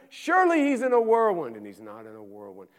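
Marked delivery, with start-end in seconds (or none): 0.1-1.6 high and loud, flung out; full-force preaching. Acted. Preacher insisting God must be in the whirlwind, loud and high. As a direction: proclaim it hard before the letdown.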